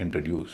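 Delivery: Introduce as IN-truh-DYOOS; light middle syllable. Shown as a demonstration. Teacher summing up the rule, light middle in introduce. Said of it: "बीच के हिस्से को हल्का किया जाएगा".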